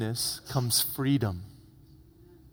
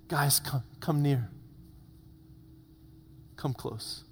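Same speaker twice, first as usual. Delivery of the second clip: soft, gentle coaxing; hushed plea, softer at the end; tender invitation; 2 semitones lower, 11 dB quieter than usual. Acted. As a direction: draw them in quietly. Joseph gently calls brothers closer, acted.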